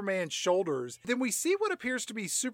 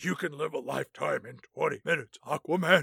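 Batman voice; 4 semitones lower, 4 dB quieter than usual. Acted. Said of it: "Batman voice continues".

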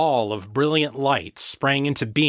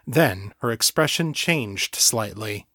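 plain reading voice

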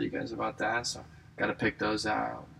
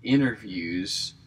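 ordinary talk, nothing marked

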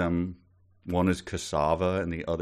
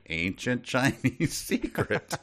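slow, drawn-out mock-mysterious line; launderer's reply; 3 semitones higher, slower than usual. Launderer answers the customer in the commercial, mock mysterious. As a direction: stretch it out like a sly advert secret. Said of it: "he's like".

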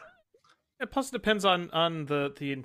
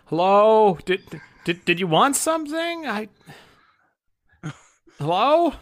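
shopkeeper calling out; 4 semitones higher, slower than usual. Acted.

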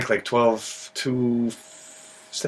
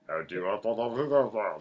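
lightly voiced, slow, lower giant drawl; a hint of a slow lumbering giant; talking like Andre the Giant; Andre the Giant impression; 3 semitones lower, 4 dB quieter, slower than usual. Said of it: "That was kinda how he talked".

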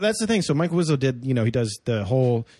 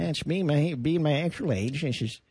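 put-on lightly held old man voice. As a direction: stay in the old-man voice while joking. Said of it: "this is what I actually sound like".

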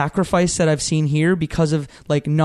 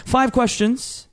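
slow, drawn-out host announcement; milk it like a quiz show host; game show host voice; 7 semitones higher, slower than usual. Acted. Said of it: "game show host voice".

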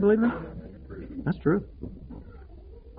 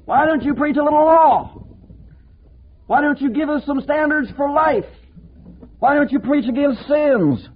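much higher, insistent demanding voice; nagging religious critics; 9 semitones higher than usual. Retold or imitated. Preacher mimics religious people nagging him to preach law, much higher. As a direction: hound him with pushy questions. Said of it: "religious people who say".

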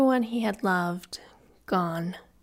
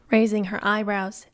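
plain reading voice

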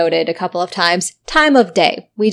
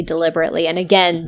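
ordinary talk, nothing marked

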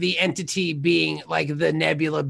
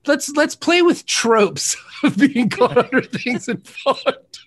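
cracking up mid-line; 6 semitones higher, 5 dB louder, slower than usual. Real, not acted. Laughs through own joke.

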